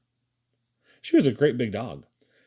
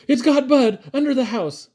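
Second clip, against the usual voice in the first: high, urgent shout of alarm; Caleb; 12 semitones higher, 6 dB louder than usual. A narrator's reading. Caleb rousing Papa from sleep, shouting in alarm. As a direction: shout it out loud, pitched way up. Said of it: "Caleb shouted".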